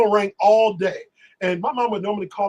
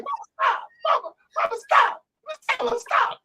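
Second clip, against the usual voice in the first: much higher, squeaky mock-pleading voice; Natalie pleading with mom. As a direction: imitate Natalie begging the mother to stop. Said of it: "and so Natalie was like".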